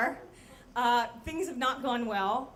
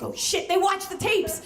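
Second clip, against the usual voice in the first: loud, higher-pitched alarm; mock panic; 6 semitones higher, 7 dB louder than usual. Narrator hearing about boss's urgent meeting, mock panic. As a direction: act out paranoid panic.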